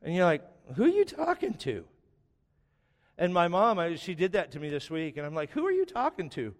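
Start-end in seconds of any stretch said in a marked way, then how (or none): 0.7-1.9 incredulous question. Retold or imitated. Son reacting to mom praying aloud. 5.5-6.5 incredulous question. Retold or imitated. Son reacting to mom praying aloud.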